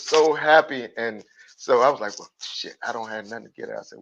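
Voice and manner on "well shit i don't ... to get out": muttering to himself. Retold or imitated. His thoughts after the TV gift.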